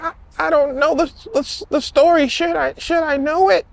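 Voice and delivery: high and flustered, tripping over the words. Retold or imitated. Waiter stammering at the angry woman, high and flustered.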